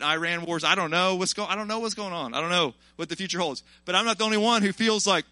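ordinary talk, nothing marked

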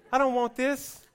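put-on high, loud toddler refusal; bratty kid. Preacher mimics a child at the high chair, loud and high. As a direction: whine it like a kid pushing the tray away. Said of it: "like a little kid at the table".

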